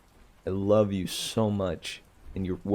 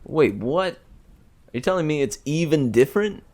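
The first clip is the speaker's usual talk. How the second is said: sudden higher, faster disbelief; surprised; 6 semitones higher, 3 dB louder, faster than usual. Real, not acted. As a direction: jolt of confusion at the news. Realizing a second pronunciation.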